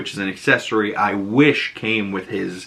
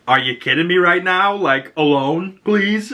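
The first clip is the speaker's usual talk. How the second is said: high, slowed mock annoyance; irritated imitated boss; exasperated; 7 semitones higher than usual. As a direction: draw out alone, then whine please. Flag annoyed at Flurry.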